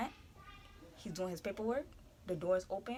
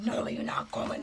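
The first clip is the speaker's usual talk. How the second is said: quiet hissed teacher voice; mock teacher; 3 dB quieter than usual. Teacher blocking the door, mock quiet warning. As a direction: imitate the teacher softly.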